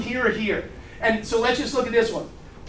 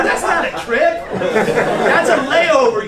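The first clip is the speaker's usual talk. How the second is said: loud, high scoffing disbelief, played for laughs; mock outrage; 4 semitones higher, 6 dB louder, much slower than usual. Advisor ribbing client over a Nashville trip, loud mock outrage. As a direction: raise the voice and stretch the put-down slowly.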